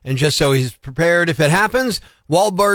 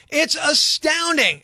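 outraged; 8 semitones higher, slower than usual. Real, not acted. Angry rant about walking.

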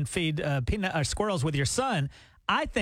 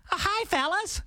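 much higher, friendly mock greeting; sweet rock star; 13 semitones higher than usual. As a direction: chirp it like a nice old star. Singer's sweet speaking voice.

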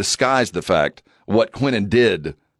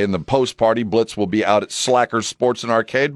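normal talking voice

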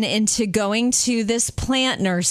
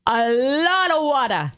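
put-on Jamaican accent. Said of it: "he had the most awesome Jamaican accent".

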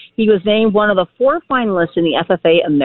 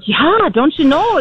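hammed-up Minnesota-Wisconsin accent, slow and much higher, "Yeah, don't you know?" drawn out; 9 semitones higher, slower than usual. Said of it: "the don't you know type of stuff".

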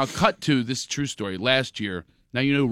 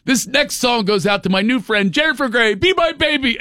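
higher and higher, a mock stage announcer; stage announcement; 10 semitones higher than usual. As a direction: announce it to the crowd, rising in pitch. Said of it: "and she goes".

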